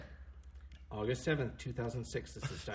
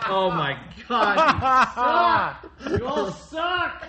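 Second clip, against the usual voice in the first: slow, high, loud laughing yell; cracking up while yelling at friends; laughing outburst; 11 semitones higher, 9 dB louder, much slower than usual. Real, not acted. Laughing at friends who ruined the take, a high slow yell.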